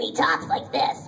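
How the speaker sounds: rough, unvoiced rasp of a little bean frog; gravelly frog. Shown as a demonstration. Showing the audience her frog character Matsu, gravelly. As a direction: croak it out in a gravelly creature voice. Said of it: "super gravelly and".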